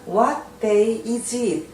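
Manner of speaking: slowly stretched question; slow model reading. Shown as a demonstration. Teacher to learners, slowly drawn-out question. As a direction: draw out each word for learners. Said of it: "teacher models English question".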